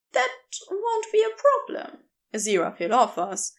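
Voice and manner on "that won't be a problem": higher, lighter voice for the kind stranger; gentle rescuer voice. Acted. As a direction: offer your name brightly, a little quicker. Narrator voices Aziraphale.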